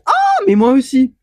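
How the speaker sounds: high, loud delighted cry; thrilled to share his course; excited exclamation. Retold or imitated. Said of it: "Je dis".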